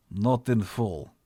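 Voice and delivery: put-on slow, terse Russian-accented reply. A narrator's reading. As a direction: answer short in a Russian accent. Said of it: "a man in a thick accent".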